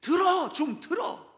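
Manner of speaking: loud, high scolding yell of listen; a parent yelling at a kid who won't listen; scolding a child. Retold or imitated. Preacher imitates a parent shouting at children, loud scolding. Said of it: "애들을 야단칠 때 이렇게도 야단치죠".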